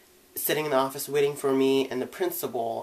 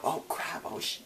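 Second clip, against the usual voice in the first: whispered; 9 dB quieter than usual. Retold or imitated. Boy mutters it under breath.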